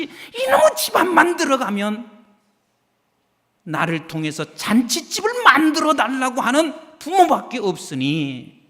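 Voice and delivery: put-on high whiny voice of a grumbling child, sharp outburst at the end; a child griping that home is a misery; voiced child complaining. A pastor voicing a child who hates coming home, whiny and high.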